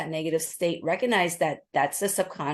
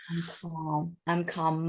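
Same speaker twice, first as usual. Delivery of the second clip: calming voice; 5 dB quieter, slower than usual. Shown as a demonstration. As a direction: say "I'm calm" softly, letting the voice settle. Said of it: "use your calming voice".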